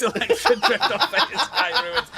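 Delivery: now actually sell the excitement; excited. Pretend. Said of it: "monotone voice ever".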